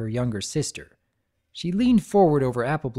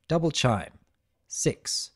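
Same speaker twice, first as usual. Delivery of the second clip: usual speaking voice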